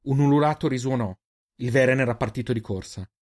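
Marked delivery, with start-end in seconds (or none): none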